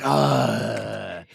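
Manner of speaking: long drawn-out groan of mock annoyance; exasperated groan. Reacting to co-host having no notes, exasperated groan. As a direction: let out a long, fed-up "ugh".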